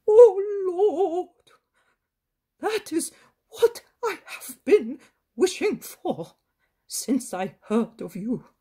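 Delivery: put-on an old man bursting with grateful relief; overjoyed grandfather. A narrator's reading. Grandfather thanking Jesus, overjoyed outcry.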